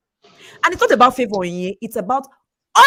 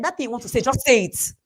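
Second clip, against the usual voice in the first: ordinary talk, nothing marked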